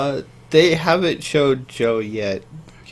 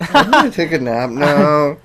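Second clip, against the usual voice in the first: mock prisoner; 3 semitones higher, 6 dB louder than usual. Mocking the bored prisoner character.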